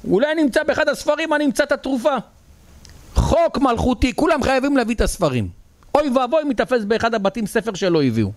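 very high-pitched, straining cry of a king; impassioned royal proclamation. Retold or imitated. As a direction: proclaim it high like an urgent decree. Acted king proclaims a decree. (הכרזה מלכותית נרגשת בקול גבוה)

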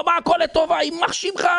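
very high, indignant mock outcry; retold screaming. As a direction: act out the wounded king's furious protest. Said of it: "והוא צועק, צורח". (צרחת זעם בחיקוי, בטון גבוה)

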